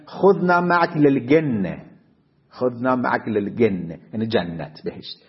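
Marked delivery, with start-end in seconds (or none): 0.1-1.8 خذنا معک للجنة with Egyptian g, للجنة said lelgenne; Egyptian Arabic. Retold or imitated. 2.5-4.0 خذنا معک للجنة with Egyptian g, للجنة said lelgenne; Egyptian Arabic. Retold or imitated.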